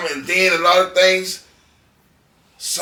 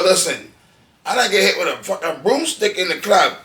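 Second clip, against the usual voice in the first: normal talking voice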